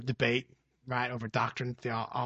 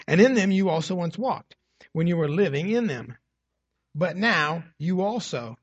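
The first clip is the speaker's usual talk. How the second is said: forceful, louder reading, slowing on the list of sins; preaching scripture; 5 dB louder than usual. Acted. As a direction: proclaim the verses to the church. Reads Colossians aloud to the congregation.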